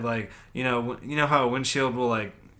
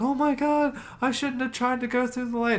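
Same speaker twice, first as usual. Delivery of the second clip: much higher mock voice of a shaken woman; upset woman driver; 13 semitones higher than usual. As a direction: pitch way up to play the shaken driver.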